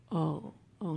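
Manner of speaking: ordinary talk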